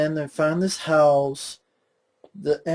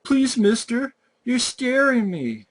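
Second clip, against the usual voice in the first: put-on high, whiny little-kid voice, pleading; mocking a kid pleading with the killer; mock scared kid; 8 semitones higher than usual. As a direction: ham up a terrified child begging. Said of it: "And then he's like".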